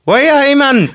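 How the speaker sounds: mock Tyneside voice, squeaky and loud; over-the-top Geordie accent. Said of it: "should have actually sounded like this".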